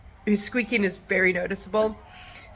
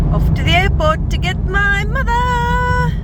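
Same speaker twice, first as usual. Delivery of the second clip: excited; 12 semitones higher, 7 dB louder than usual. Real, not acted. Excited trip to airport.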